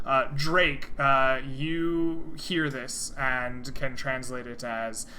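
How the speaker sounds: ordinary talk, nothing marked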